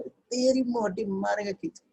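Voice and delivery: high, slow cartoonish taunt; mock fight taunt. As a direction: taunt her like a TV cartoon bully. Students' mock fight, voiced taunt. (ऊँची, धीमी cartoon जैसी धमकी)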